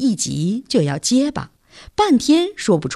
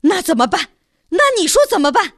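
higher, fast helpless outburst; stamping frustration; 10 semitones higher, 3 dB louder, faster than usual. A narrator's reading. Scolded son yells back at his mother, flustered. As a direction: stamp and demand an answer. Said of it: "跳脚说".

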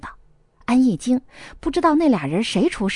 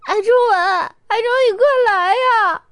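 high, loud sobbing plea; wailing cry; 11 semitones higher, 5 dB louder than usual. A narrator's reading. Ge Yiqing on the phone begging Chongwen to come, wailing. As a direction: sob and cry out for him. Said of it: "已经是哭得嗷嗷的了".

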